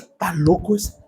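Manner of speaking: low, soft mock aside; hushed dig at a passing oddball; muttered judgement. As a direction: mutter it like a disapproving onlooker. Voices onlookers judging him crazy.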